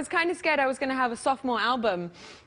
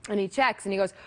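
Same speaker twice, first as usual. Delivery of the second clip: normal talking voice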